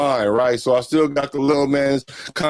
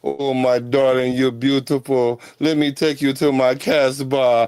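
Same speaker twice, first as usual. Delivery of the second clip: ordinary talk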